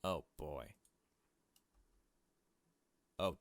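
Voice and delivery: normal talking voice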